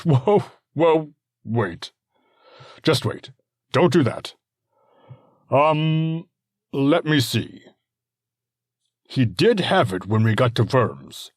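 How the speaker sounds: a father suddenly eager to help; hasty backpedaling. Acted. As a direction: lord stopping the messenger from leaving.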